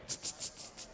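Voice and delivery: soft, whispered tsk tsk tsk; mock disapproving tuts; tutting. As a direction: tut quietly behind your hand. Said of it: "laugh behind your hand and go".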